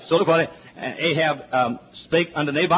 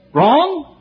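sarcastic; 10 semitones higher, 6 dB louder than usual. Real, not acted. Sarcastic then emphatic answer.

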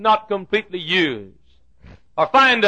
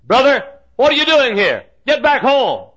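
scolding brother; 8 semitones higher, 5 dB louder than usual. Acted. Next brother voiced scolding David.

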